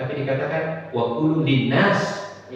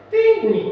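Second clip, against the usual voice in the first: phone ding; 5 dB louder than usual. Retold or imitated. Imitating phone notification sound.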